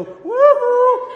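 piercingly high, loud woo-hoo; imitated crowd whoop. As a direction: whoop like hundreds cheering at once. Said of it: "African pastors go".

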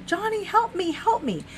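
high-pitched pleading, held-back volume; retold yell for help. Dreamer pleading with John to help her, mock panicked yell. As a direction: act out begging for rescue. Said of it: "And I yelled".

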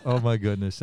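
ordinary talk

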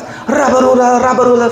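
high, babbling nonsense syllables; mock tongues. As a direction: lampoon an over-eager tongues-speaker. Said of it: "just start speaking in tongues".

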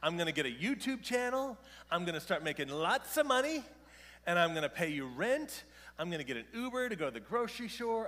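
put-on high, loud boasting kid; lightly voiced son, loud and proud; cocky son. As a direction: play the eleven-year-old bragging big plans. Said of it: "What if he just says".

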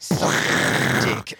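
slow, hushed line; echoing the movie line in a slow whisper. Retold or imitated. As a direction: breathe it out slowly.

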